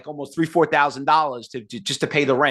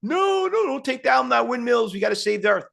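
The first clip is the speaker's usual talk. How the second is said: very high, squealing mock panic over windmills; mock panicked protester; 8 semitones higher than usual. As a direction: parody a frantic environmentalist. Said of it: "some people like freaking out".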